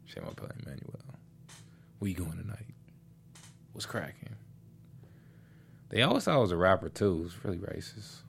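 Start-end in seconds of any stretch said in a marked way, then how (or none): 0.1-1.0 very low, hushed pickup line; put-on deep seducer's murmur. Shown as a demonstration. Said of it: "You gotta talk like this". 2.0-4.3 hushed, put-on pickup voice; very soft flirting in a low register; deep voice. Shown as a demonstration. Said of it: "with a deep voice".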